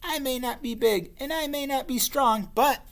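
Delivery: high, plucky bat; little bat voice. Acted. Little bat answering the laughing monsters, plucky. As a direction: speak up, small but bold. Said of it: "the bat said".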